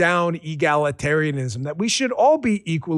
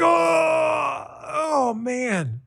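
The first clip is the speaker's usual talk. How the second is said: higher mock pained groan; mock umpire groan; 9 semitones higher, much slower than usual. Imitating the umpire hit a third time, mock groan. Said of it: "he was like".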